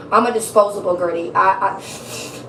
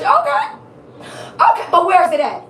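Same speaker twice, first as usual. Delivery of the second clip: high, loud mock-questioning; impatient audience impression; 8 semitones higher, 3 dB louder than usual. Reader imitating how some of her viewers sound, impatient. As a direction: mimic impatient listeners with a pitched-up voice. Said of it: "that's how some of y'all is".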